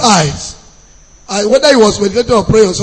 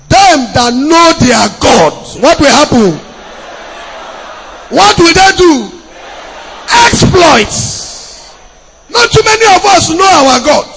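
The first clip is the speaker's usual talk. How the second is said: preaching at full force; 5 semitones higher, slower than usual. Acted. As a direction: build it up and ring it out to the church. Preacher proclaims to congregation.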